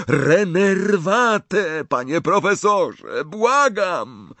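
slow, high, loud pleading spell; desperate cry. A narrator's reading. Harry reviving Dumbledore, desperate cry. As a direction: cast the spell like a prayer.

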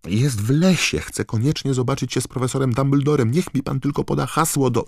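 high, loud urgent pleading; Harry shouting. A narrator's reading. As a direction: shout the plea, desperate to get through. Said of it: "krzyknął Harry". (głośne, naglące błaganie wysokim głosem)

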